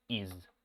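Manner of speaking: "Is" said low and soft, ending on a voiced z; pronouncing is. Shown as a demonstration. Teacher repeating the sound for students. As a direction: murmur "Is" ending on a buzzing z. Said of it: "este sonido se va a pronunciar con".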